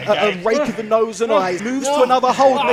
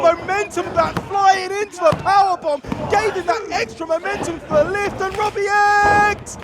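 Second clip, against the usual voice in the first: wrestling commentator; 7 semitones higher than usual. Real, not acted. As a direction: shout the big move like a commentator. Excited wrestling commentary call.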